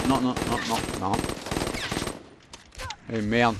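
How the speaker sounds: ordinary talk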